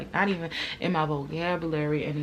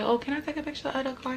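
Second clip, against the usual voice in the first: high, quick sugary ask; sweet talking; 8 semitones higher, faster than usual. Retold or imitated. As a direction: sweet-talk her, high and fast. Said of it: "while I'm talking sweet".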